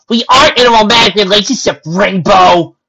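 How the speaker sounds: loud, high protest; indignant denial. Acted. Pony denying a romance to Rainbow, loud indignant protest. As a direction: shout down the teasing. Twilight denies teasing indignantly.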